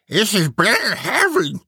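high moan through a mouthful; moaning while chewing. Acted. Preacher relishing hot offal, high moan while chewing. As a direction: moan it with food in your mouth. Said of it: "he moaned, licking his lips while chewing".

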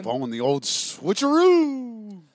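drawn-out, high, loud switcheroo; big playful holler, stretching the last word; playful whoop. Real, not acted. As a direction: sing out switcheroo, long and loud. Playful announcement of switching seats.

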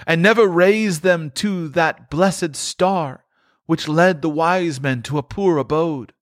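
high, solemn ghostly lament; a tormented spirit grieving over his wasted life; mournful ghost, pitched high; Marley's ghost. Acted.

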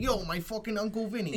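put-on New York Italian accent; casual New York Italian impression. Shown as a demonstration. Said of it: "I could do a New York Italian accent".

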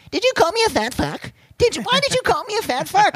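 very high-pitched, squealing mock outrage; Rob Ford. Ford cornering a reporter, in squeaky mock outrage. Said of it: "Mammoliti and Rob Ford cornering".

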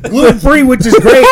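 loud, mocking praise; sarcastic shout. Real, not acted. Ranting to his friend about gluten-free food, sarcastic shout. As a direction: belt out the fake enthusiasm.

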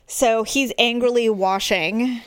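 ordinary talk, nothing marked

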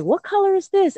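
put-on high, sing-song question to a child; bright teacher voice. Teacher asking a calmed child, high and playful. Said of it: "and I'm like".

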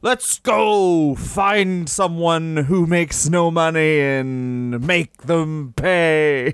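very slow, stretched-out proclamation; hammed-up villainous dad. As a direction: drag every word out like a cartoon tycoon. Plays the screaming father on.

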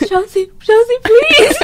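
hammed-up desperate calling; pleading call. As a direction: imitate a mom begging you to wait. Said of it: "then she just over here started".

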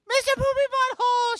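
very high acted squeal; hammed-up excited teen. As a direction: squeal the name in delight. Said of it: "She's so excited".

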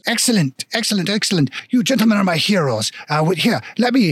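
delighted mock-French dwarf; grateful, hammy French accent; bad French accent. Dwarf shopkeeper praises his heroes, bad French accent. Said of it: "very shitty French accent".

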